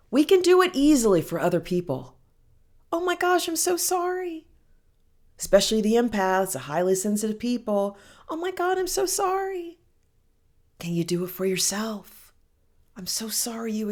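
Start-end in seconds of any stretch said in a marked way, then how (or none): 2.9-4.5 high-pitched cooing condolence; gushing sympathy. Acted. Mimics easy sympathy for others. 8.3-9.8 high, cooing pity; empath's gushing sympathy. Acted. Mimics empaths consoling other people.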